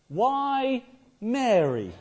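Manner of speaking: why drawn out very slowly and high; asking the congregation to ponder; slow rhetorical question. Acted. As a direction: let why hang, very slow.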